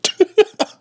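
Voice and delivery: high squealing laugh. Real, not acted. Laughing at mocked nerd.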